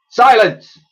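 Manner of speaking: loud, high barked order; commanding shout. A narrator's reading. Professor silencing the chattering Dotty, loud command. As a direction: cut them all off at once. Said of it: "ordered the professor".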